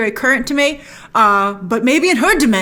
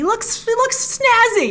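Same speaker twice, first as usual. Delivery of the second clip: slow, high "snazzy" with a grin; admiring; 12 semitones higher, slower than usual. Real, not acted. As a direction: savour the word snazzy. Admiring the costume colours.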